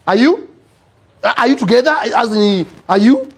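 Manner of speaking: high, rising disbelief as an acted doctor; retold doctor's insistent double-check; incredulous questioning. Doctor doubts the couple are married.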